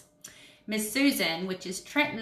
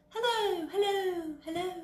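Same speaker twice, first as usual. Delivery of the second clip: high calls fading away softer; hello echoing off cave walls; 9 semitones higher, 5 dB quieter than usual. Shown as a demonstration. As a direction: make it echo and fade. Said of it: "can you make an echoing noise".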